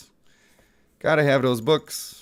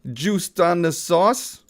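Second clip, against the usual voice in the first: slow, higher, hammed-up catchphrase; quoted slang saying; 6 semitones higher, much slower than usual. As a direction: stretch the slang out for fun. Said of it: "as they say".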